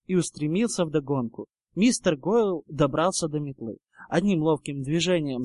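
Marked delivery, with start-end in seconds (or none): none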